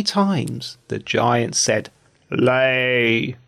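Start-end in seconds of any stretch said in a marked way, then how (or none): 2.3-3.4 giant's command. Acted.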